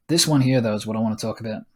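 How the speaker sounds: ordinary talk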